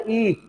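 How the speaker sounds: ई held as a clean long ee sound; vowel demo. Shown as a demonstration. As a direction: sound out ई on its own, clearly. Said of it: "ई आप बोलोगे ना". (ई की ध्वनि स्पष्ट बोलकर दिखाना)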